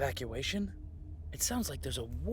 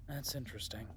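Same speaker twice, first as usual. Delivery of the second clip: breathy whisper to himself; whispered aside; 2 semitones lower, 6 dB quieter than usual. Acted. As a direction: murmur it as you notice. Noticing something, to himself.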